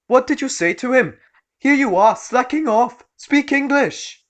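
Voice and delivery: high, loud, furious rant; angry shrill shout. Acted. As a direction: berate him like an entitled mum. Entitled mum berating waiter.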